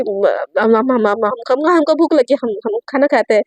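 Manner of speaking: low stuttering start; nervous stammer. Acted. Satya dodges the teasing by asking to eat, stammering. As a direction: stumble into a hurried excuse.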